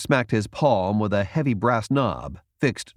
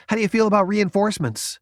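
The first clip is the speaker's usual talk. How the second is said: put-on eager young Jason; 11 semitones higher, 3 dB louder, faster than usual. A narrator's reading. Jason greets Valentino eagerly.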